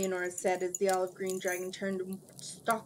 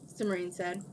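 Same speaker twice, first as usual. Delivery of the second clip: ordinary delivery, nothing marked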